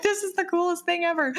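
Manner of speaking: high, fast thrilled gush; excited. Retold or imitated. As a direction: gush like a delighted kid. Excited retold thought.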